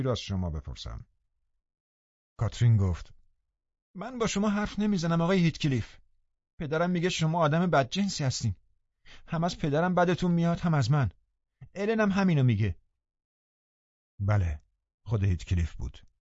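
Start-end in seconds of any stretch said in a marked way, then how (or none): 3.9-12.8 higher-pitched voice of Catherine refusing him; defiant Catherine. A narrator's reading. Catherine tells Heathcliff she won't talk to him, defiant. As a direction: speak up high, snubbing him.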